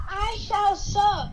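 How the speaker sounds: slow, grand put-on voice; mock formal voice. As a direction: read it like a pompous lord. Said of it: "there's someone says".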